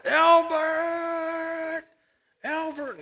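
drawn-out high holler across the street; imitated child's holler. Boy calling the neighbor from the porch, imitated holler. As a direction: call the name high and long, mimicking a boy. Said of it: "he was on the front porch hollering".

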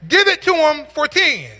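a bidder yelling to hurry the sale; impatient shout. Retold or imitated. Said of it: "someone shouted".

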